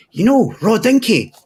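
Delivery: a lightly voiced kid, high and slow; a hint of a little girl's voice; imitating little sister. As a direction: mimic the sister, all innocent. Voicing little sister on bus.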